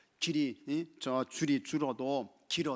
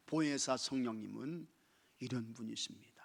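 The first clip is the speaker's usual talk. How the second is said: ordinary talk, nothing marked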